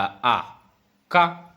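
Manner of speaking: "आ" then "का" sounded out with a long open vowel at the end; drawn-out vowel. Shown as a demonstration. Teacher showing students the hidden vowel, drawn out. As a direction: stretch "का" so the "आ" is heard. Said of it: "ऐसे निकलेगी ध्वनि का".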